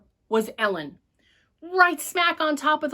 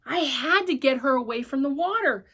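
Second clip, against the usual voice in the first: ordinary delivery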